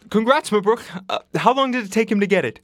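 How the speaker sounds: high, loud, fast mimicry of an excited neighbour; eager congratulations. Nurse imitating his neighbour's next question, eagerly excited.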